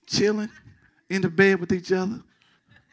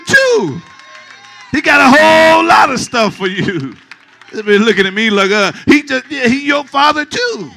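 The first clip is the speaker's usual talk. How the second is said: loud, high-pitched exhortation climbing higher; forceful preaching; 7 semitones higher, 9 dB louder than usual. Real, not acted. Pastor urging church to know the Father, forceful preaching. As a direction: preach it loudly, pitch rising as you go.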